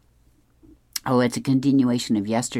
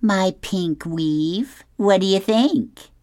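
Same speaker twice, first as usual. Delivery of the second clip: put-on higher-pitched, drawn-out comic-strip girl; Michelle; 8 semitones higher, slower than usual. A narrator's reading. Cool girl to admirer about new hair, higher character voice. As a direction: show it off in a raised, lightly acted voice. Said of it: "And she says".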